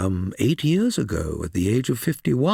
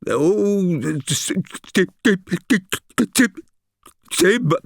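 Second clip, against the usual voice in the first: nervous stammer; 8 semitones higher, 3 dB louder than usual. Acted. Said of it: "to trip nervously over my own tongue".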